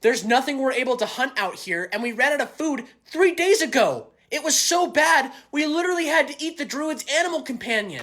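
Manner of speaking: high, heated complaint; agitated. Acted. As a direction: build up the exasperated grievance. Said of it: "everyone's getting pretty agitated".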